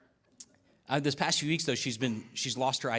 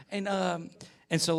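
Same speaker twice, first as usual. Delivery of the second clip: ordinary talk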